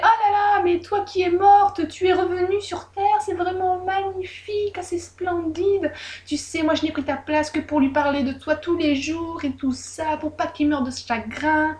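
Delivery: voix haute, lente et mielleuse; fausse admiration. A narrator's reading. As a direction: flatter sa sœur d'une voix aiguë et traînante. Oulek feint l'admiration. (la sœur hypocrite joue l'émerveillement, aiguë et lente)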